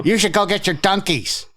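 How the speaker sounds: lightly voiced nagging coffee fan; mock fan. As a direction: needle them like a pushy fan. Mocking Dunkies fans.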